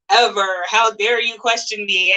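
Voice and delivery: normal talking voice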